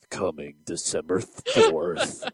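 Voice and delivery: slow, low movie trailer voice; trailer announcer. Pretend. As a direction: draw it out like a trailer.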